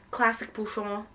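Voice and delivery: "bouchon" tried in French, boo-SHON; a careful stab at the French word; attempted French. Shown as a demonstration. Admitting she is butchering the pronunciation, attempted French. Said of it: "I'm butchering this pronunciation".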